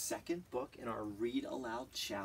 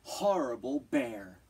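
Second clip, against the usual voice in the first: louder, cross grumble-shout; angry shout; 6 dB louder than usual. Acted. The girl stomping home, angry shout. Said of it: "She stomped all the way home".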